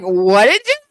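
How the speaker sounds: mock incredulous demand. Imagining someone calling her pumpkin, she mockingly demands a repeat, laughing. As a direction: dare them to say pumpkin again, cracking up. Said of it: "Be like".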